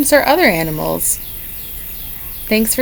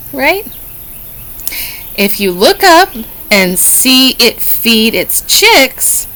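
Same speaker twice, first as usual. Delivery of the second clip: normal talking voice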